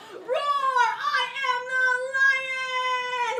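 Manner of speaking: loud, high, long-held lion bellow; bigger lion roar. Acted. Puppeteer starting bigger to pull the audience in, huge lion roar. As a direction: overplay the lion, stretching the last word. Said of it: "I should start bigger".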